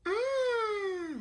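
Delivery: very high, steady, held "Ah" like a bell; high fairy tone. Shown as a demonstration. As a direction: ring out a pure high note like an electronic bell. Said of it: "like that".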